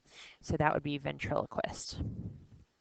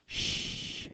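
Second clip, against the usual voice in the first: a soft unvoiced shh for silence; shushing sound; 8 dB quieter than usual. Shown as a demonstration. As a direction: hush them gently with a shh. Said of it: "hush means quiet".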